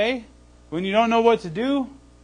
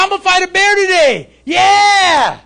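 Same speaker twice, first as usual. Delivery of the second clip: shouting, much higher and louder; gung-ho; 10 semitones higher, 9 dB louder, slower than usual. Pretend. David as the preacher imagines him, waking up: gung-ho shout. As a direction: whoop like a fired-up fighter.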